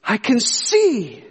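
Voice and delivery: high, drawn-out cry of amazement; exclaiming. Retold or imitated. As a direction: retell a boy's astonished exclamation, stretching the words. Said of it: "and he exclaimed".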